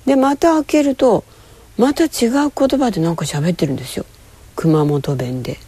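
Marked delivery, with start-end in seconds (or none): none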